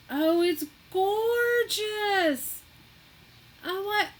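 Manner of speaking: high, loud, slow marvelling; swooning over the beautiful gift; awed delight. Real, not acted. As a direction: stretch it out in wonder. Seeing the gift.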